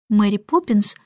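ordinary delivery, nothing marked

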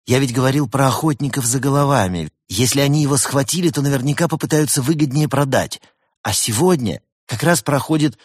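high-pitched, agitated insistence; urgent explaining. A narrator's reading. Теодор explains the hunters' plan to the koala, urgently. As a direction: press the point urgently.

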